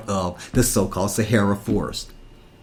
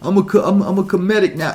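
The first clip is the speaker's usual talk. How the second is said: high mocking voice of a smug convert; lightly mocking know-it-all voice; mocking imitation; 8 semitones higher than usual. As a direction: mimic them, pitched up and sarcastic. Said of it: "Then you go and say".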